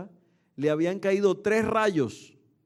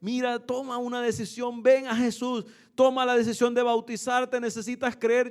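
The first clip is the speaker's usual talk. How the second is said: higher, urging preacher's call; retold altar call from the pulpit; 5 semitones higher than usual. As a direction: invite the crowd to come forward. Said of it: "estaba era haciendo un llamado".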